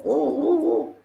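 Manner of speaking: high-pitched honking "oh, oh"; imitated car horn. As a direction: mimic a driver honking the horn. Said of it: "and horn".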